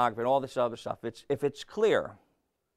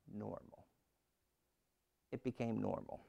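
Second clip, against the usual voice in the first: hushed; 6 semitones lower, 16 dB quieter than usual. Real, not acted. Preacher drops to hush for effect.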